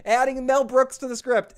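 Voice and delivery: beaming director declaring it a hit; mock thrilled exclamation; excited shout. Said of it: "She goes".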